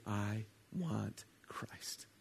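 slow hushed whisper. Pretend. Preacher voicing a listener's prayer in a slow whisper. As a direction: breathe it out slowly, barely voiced.

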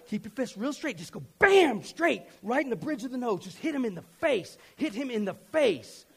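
hammed-up, high-pitched fight coaching; worked-up dad acting out his anger; getting angry. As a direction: egg him on, punching out BAM loud. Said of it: "I'm getting angry. I'm like".

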